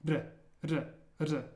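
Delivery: "R" as a quick tongue tap, close to a d; tapped R. Shown as a demonstration. Teacher showing a workaround for learners who struggle, tapped R. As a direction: tap the roof of the mouth on "R". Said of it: "tapping your tongue against the roof".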